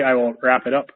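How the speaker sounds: normal talking voice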